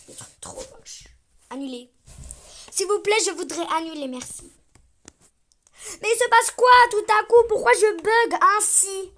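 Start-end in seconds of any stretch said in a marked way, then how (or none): 6.0-9.1 high, loud alarmed cry, then slowing down; loud, high startled outcry; shocked outburst. Real, not acted. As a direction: yelp in disbelief at the glitch. Avatar glitches suddenly.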